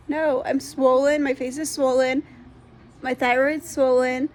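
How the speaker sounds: ordinary talk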